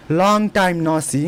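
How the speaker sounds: very slow, each word drawn out; slow model phrase. Shown as a demonstration. Teacher slowly modelling a greeting for students. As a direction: lay it out slowly for learners. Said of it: "इस type से बोलेंगे".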